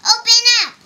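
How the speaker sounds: very high, loud shouted order. A narrator's reading. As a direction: yell it through a closed door. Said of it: "he shouted".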